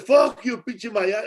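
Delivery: high, loud mock snarl; thug yelling. Thug cursing the doorman. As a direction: fling it back at him. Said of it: "Then he said".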